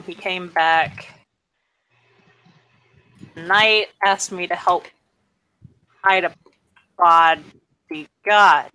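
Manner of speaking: normal talking voice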